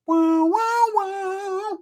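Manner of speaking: high, falsetto wows of awe; amazed wow. Retold or imitated. Buddy imitating his amazed reaction, high awed wows. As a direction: gasp it out like you just saw something amazing.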